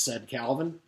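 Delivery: usual speaking voice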